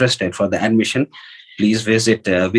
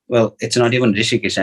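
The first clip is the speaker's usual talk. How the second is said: usual speaking voice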